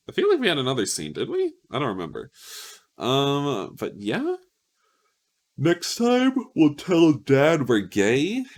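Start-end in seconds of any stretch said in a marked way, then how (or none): 0.1-1.5 squeaky unsure question. Real, not acted. Unsure whether another scene happened. 5.6-8.4 higher, louder and slow, drawn out with glee; excited teaser. Real, not acted. Teasing the next episode.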